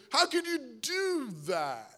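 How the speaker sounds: drawn-out, scandalized scolding; mock parental outrage. Parent to child who misbehaved, mock outrage. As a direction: act out an appalled parent, slowly.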